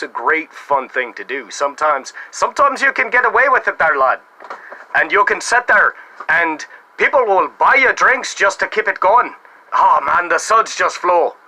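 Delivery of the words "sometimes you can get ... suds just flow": higher, lilting mock Irish accent; bad Irish accent. Shown as a demonstration. Bar prankster blurting out for free drinks, in a bad Irish accent. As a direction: do your best worst Irish brogue. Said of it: "in an Irish accent my best, worst attempt ever".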